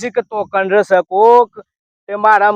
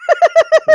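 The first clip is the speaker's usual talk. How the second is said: high-pitched burst of laughter; 3 dB louder than usual. Acted. Villager amused by the visitor's odd name, laughing.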